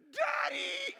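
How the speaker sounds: sudden high cry of Daddy; shouted Daddy. Retold or imitated. Pastor reliving a fall, crying out for his dad. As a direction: blurt it out like a scared child calling. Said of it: "I was just like".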